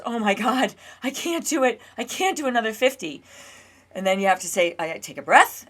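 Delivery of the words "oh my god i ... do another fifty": higher, panicky voice of her own nerves; panicking nervous system. Retold or imitated. Her nervous system panicking at mile fifty, higher panicked voice. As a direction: voice the body's panic, pitched up. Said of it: "my entire nervous system reacts to that and says".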